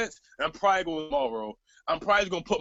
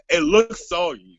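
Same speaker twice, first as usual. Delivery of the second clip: slow, louder quote of her text; slowed, louder mimicry; mock quote; 3 semitones lower, 8 dB louder, slower than usual. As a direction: savour the line mockingly. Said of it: "giving me quotables after quotables".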